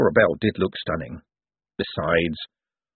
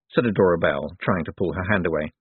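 ordinary delivery, nothing marked

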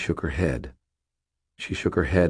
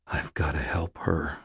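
ordinary delivery, nothing marked

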